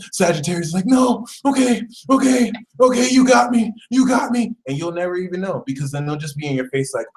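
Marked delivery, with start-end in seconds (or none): 0.9-4.5 high, whiny capitulation; hammed-up helpless whine; wailing surrender. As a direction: give in as if defeated. Sagittarius gives in to Scorpio.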